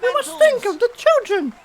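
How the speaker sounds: squeaky high mock preacher. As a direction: parrot it back in a high squeal. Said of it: "repeats NPC line mockingly".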